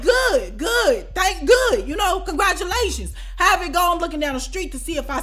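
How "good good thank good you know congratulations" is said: high, impatient fake praise; sarcastic congratulations. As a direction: brush him off with fake praise.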